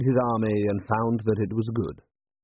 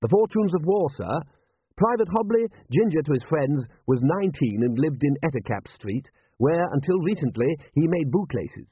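higher-pitched butler voice with lively swings; deferential butler; 6 semitones higher than usual. A narrator's reading. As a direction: report respectfully as a formal manservant. Butler figure in narrated story.

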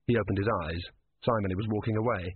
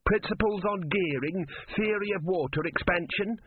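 mild high-pitched shout; 9 semitones higher than usual. A narrator's reading. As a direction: call out the titles, pitched up. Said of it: "shouted Ern".

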